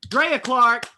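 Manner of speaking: big curtain-call introduction; announcer roll call. Real, not acted. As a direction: present the performer to applause. Host's roll call of the cast.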